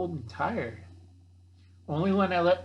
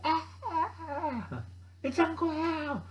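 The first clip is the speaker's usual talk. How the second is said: high, slow mock panic scream; terrified bears; 12 semitones higher, slower than usual. Bears see Uncle Al and run, high mock screaming. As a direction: shriek and flee like scared animals.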